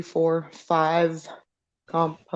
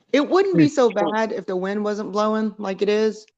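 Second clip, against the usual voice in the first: usual speaking voice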